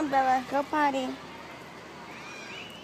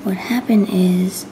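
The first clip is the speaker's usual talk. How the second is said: whispering; 7 dB louder than usual. Real, not acted. Whispers to camera throughout.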